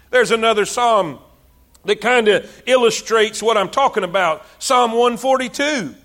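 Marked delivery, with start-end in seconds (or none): none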